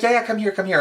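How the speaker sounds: high, welcoming retold reply; imitated eager beckoning. Star retold inviting fan for a picture, high eager beckoning.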